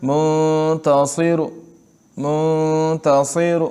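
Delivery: منتصر drawn out slowly, final vowel half sounded; slow demo of rawm. Shown as a demonstration. Teacher slowly modelling منتصر for students. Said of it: "اس کو روم کہتے ہیں".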